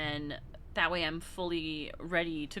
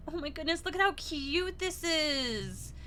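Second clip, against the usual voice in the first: higher, slow squealing delight; gushing; 8 semitones higher, slower than usual. Real, not acted. Streamer gushing over a cute card, high squeal. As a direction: coo over it like a puppy.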